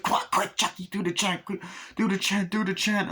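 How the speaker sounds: high, hushed-then-voiced frantic urging; urgent pleading. Urging Chucky as cops approach.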